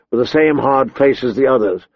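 usual speaking voice

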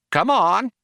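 high, loud, matronly command; stern. A narrator's reading. Computer chides the crew for a confession, stern. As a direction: order it like a strict nanny. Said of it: "said Eddie sternly".

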